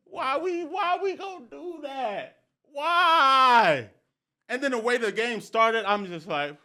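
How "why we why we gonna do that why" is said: high-pitched whining protest, last word drawn out loud; exasperated pleading. Retold or imitated. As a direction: plead like a distressed fan. Acting out pleading with the team.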